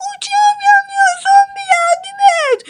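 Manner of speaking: yüksek sesle yardım çığlığı; panik. Acted. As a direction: zombiden yardım diler gibi bağır. Ahmet yardım istiyor. (uçağı yanan Ahmet zombiden yardım istiyor)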